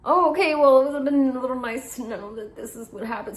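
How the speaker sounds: high, loud, pointed sarcasm; sarcastic dismay. Retold or imitated. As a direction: let the annoyance leak through politely. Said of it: "And I was like".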